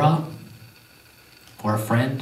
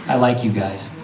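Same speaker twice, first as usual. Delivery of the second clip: normal talking voice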